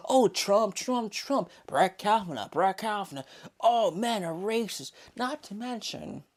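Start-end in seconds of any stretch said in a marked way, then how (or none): 0.1-4.9 high, whiny mock voice; mocking liberal. Vlogger mocks Trump-obsessed critics, high whiny mock voice. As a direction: sneer it like a panicked pundit. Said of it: "You go".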